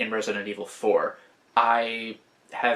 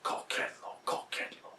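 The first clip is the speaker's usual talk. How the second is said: hushed, voiceless whisper; cultist whisper; 12 dB quieter than usual. Retold or imitated. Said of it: "That's when they whisper that".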